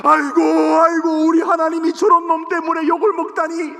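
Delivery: high, loud crying wail; wailing lament. Retold or imitated. David lamenting God's insult, loud wailing cry. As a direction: wail aigo in grief. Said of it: "다윗이 막 아마 울었을 거야".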